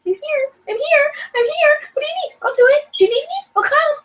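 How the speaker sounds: very high, eager, fawning voice; eager puppy dog. Retold or imitated. Julie as Annabelle's clingy puppy dog, high eager fawning. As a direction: play the clingy little puppy.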